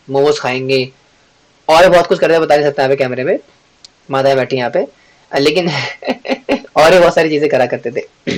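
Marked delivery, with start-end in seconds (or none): 5.7-6.7 soft, quiet chuckle. Real, not acted. Teacher hiding past habits from camera, chuckling. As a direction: laugh lightly to yourself.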